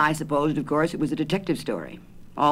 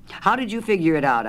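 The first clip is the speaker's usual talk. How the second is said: demanding; 8 semitones higher, 4 dB louder than usual. A narrator's reading. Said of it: "I demanded".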